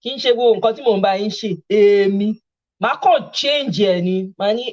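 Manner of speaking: ordinary talk, nothing marked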